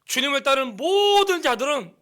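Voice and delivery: high, loud, fervent preaching; preaching at full force. Real, not acted. Pastor urging every believer, in full preaching force. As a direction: drive the point home to the whole church.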